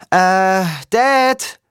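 loud, high, hesitant call. A narrator's reading. Son calling his sea-god father from the shore, calling out. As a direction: call out across the water, drawn out. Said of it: "rief ich".

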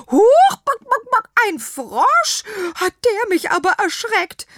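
high, loud startled clucking; startled hen. A narrator's reading. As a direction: jump with fright and cluck. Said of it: "gackerte Rosenrot".

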